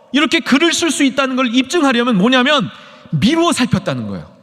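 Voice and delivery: high, loud, insistent argument; forceful preaching. Real, not acted. Preacher argues Luke was no eyewitness, forceful high delivery.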